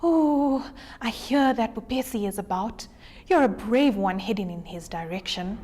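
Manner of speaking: soft, shaky tortoise whisper; hushed and nervous, barely peeking out. Acted. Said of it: "the tortoise whispered shakily".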